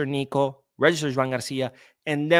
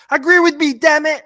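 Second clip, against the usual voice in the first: high, loud outburst; hammed-up furious demand; mock losing it; 10 dB louder than usual. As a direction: shout it like an exasperated tantrum. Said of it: "you don't see me losing my shit".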